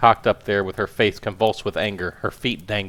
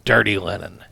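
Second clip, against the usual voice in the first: ordinary delivery, nothing marked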